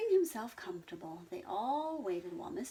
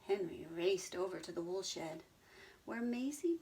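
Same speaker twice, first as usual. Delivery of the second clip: usual speaking voice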